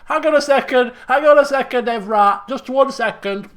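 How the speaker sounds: loud, hurried boss stalling; a lightly voiced flustered boss. Player voices the boss character.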